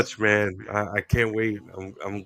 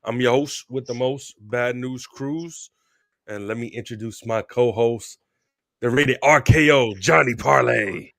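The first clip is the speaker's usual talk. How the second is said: big hype ring-announcer intro; 4 dB louder than usual. Acted. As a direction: call it out like a wrestling announcer. Opens the show like a ring announcer.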